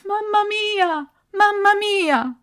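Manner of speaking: very high, drawn-out cry for mamma; desperate cry. A narrator's reading. Ardo buried alive calls for his mother, desperate cry.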